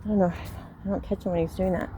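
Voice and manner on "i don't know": ordinary talk, nothing marked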